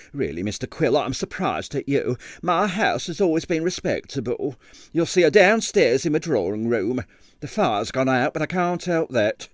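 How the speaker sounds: a hint of a scandalised landlady; prim landlady. Landlady scolding Quill about respectability, lightly voiced. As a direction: scold him primly. Miss Treacle in character voice.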